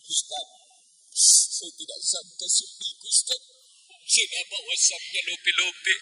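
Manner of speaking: high, soft, slow sad whine; tearful wife. Retold or imitated. A wife confides her heartbreak to the preacher, in a soft high whine. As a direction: whimper it softly like a hurt wife. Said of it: "Ada curhat sama saya".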